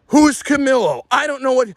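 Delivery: high, loud, rising exasperation; exasperated outburst. Retold or imitated. Retelling the row over who Camilo is, exasperated. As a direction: escalate into a high, frustrated yell.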